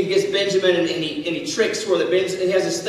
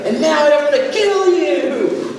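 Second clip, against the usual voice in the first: mock dread; 6 semitones higher, 3 dB louder, slower than usual. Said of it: "it's like you're thinking".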